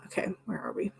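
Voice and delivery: breathy whisper to herself. Real, not acted. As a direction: mutter it under your breath. Muttered to herself.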